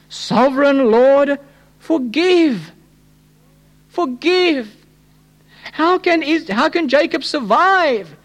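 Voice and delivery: high, drawn-out plea to God; crying out. Acted. Preacher voicing the prophet begging mercy for Jacob, crying out. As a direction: cry it out slowly, pitched high in anguished appeal. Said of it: "I cried out".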